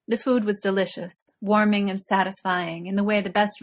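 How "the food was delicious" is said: usual speaking voice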